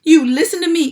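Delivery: loud, high urgent cry. A narrator's reading. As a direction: demand his attention. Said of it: "cried Wilbur".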